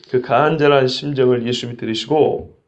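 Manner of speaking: ordinary talk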